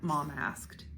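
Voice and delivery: usual speaking voice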